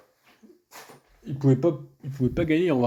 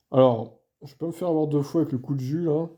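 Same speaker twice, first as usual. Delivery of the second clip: ordinary talk, nothing marked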